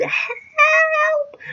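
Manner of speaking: very high, thin, held-back scream; mock scream. Girl screaming as stepfather grabs her, restrained high mock scream. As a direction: imitate a scream without full volume. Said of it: "really loud".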